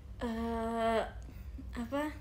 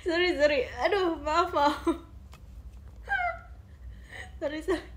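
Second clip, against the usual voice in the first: laughing apology; 3 semitones higher than usual. Real, not acted. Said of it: "Aku kayak gak bisa ngomong".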